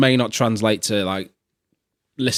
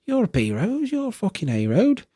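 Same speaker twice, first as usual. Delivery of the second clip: high-pitched incredulous rant; acted high-pitched outrage; exasperated barman; 13 semitones higher than usual. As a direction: berate them in a high, indignant voice. Barman scolds scared roads in joke.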